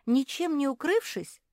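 high, loud plaintive question; acted near-sob of protest; whimpering complaint. A narrator's reading. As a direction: wail it lightly, in dismay. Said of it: "всхлипнула Марсия".

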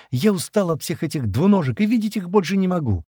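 high, distressed complaint; fed up and upset, pitched high; trembling plea. A narrator's reading. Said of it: "дрожа всем телом". (жалобно, на высокой ноте)

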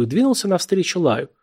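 usual speaking voice

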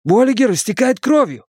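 high-pitched, frantic report; distressed high cry; urgent alarm. A narrator's reading. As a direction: plead for action for a wounded friend. Friend bleeding, calls to leader.

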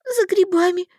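high, loud sobbing answer; sobbing girl. A narrator's reading. Girl answers Darvald, high and sobbing. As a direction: sob it out high. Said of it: "всхлипнула я".

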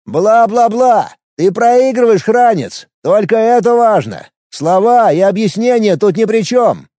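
mocking. A narrator's reading. Alex jeers.

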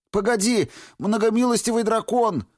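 high, loud desperate yell. A narrator's reading. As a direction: cry out to stop the dragon. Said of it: "завопил он".

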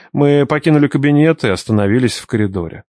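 ordinary delivery, nothing marked

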